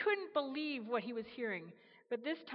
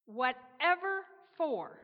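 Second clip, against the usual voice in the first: slow, louder, incredulous asking; baffled question; 5 semitones higher, 6 dB louder, slower than usual. Acted. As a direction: stretch it out in disbelief. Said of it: "Gabriel asked".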